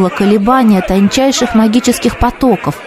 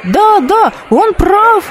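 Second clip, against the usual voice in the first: higher, eager crowd voice; excited agreement; 12 semitones higher, slower than usual. Acted. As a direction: cheer the old man on. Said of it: "неслось с одной стороны". (выше, возбуждённо соглашаясь)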